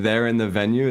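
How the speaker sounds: normal talking voice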